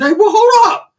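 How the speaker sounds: high, loud startled cry; shocked double take. Retold or imitated. Seeing his tio's Bulldog tattoo, high startled cry. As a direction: stop everything in shock.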